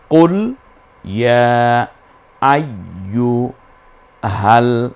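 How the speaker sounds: drawn-out chanted verse; slow recitation. Shown as a demonstration. Teacher recites the verse piece by piece. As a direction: lengthen each syllable.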